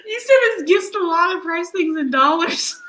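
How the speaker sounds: high, loud, words breaking into laughter; laughing while reading. Real, not acted. Host rereading a viewer's comment aloud, laughing. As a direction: tries to read the comment but keeps cracking up. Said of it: "That was just too much for me".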